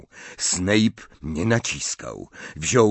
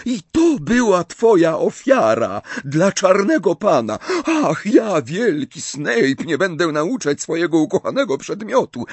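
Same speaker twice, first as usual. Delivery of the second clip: much higher, sneering imitation of Snape; mock him with scorn; 11 semitones higher, 5 dB louder than usual. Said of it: "zadrwiła".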